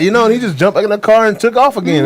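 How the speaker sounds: normal talking voice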